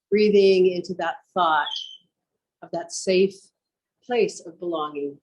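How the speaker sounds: measured yoga-teacher voice with long pauses; guided meditation. Real, not acted. Teacher guiding students to picture home, meditative delivery. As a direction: guide them inward, leaving space after each phrase.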